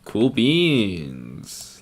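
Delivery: "beans" held long with a falling glide; drawn-out catchphrase. Shown as a demonstration. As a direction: stretch BEANS out long, gliding down. Same phrase repeated, drawn out.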